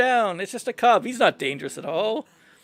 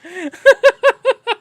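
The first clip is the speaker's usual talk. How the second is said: a loud, high, falling laugh; 8 dB louder than usual. Acted. Ruler laughing at the poet's grand promise, high laugh.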